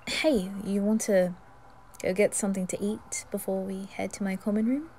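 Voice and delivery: usual speaking voice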